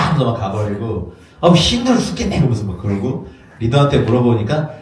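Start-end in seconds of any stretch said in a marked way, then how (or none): 1.4-2.4 mock whine. Wise men complaining.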